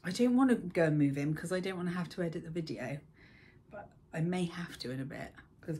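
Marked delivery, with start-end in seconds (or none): none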